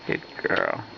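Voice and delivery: breathy whisper, no voice; whispered praise. Real, not acted. Mom whispering praise to the baby. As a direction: hush it close to her ear.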